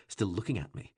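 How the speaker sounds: ordinary delivery, nothing marked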